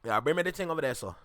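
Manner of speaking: casual Caribbean patois accent; Jamaican patois. Shown as a demonstration. Demonstrating patois to listeners, Jamaican patois accent. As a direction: slip into Jamaican patois for a request. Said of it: "that's patois".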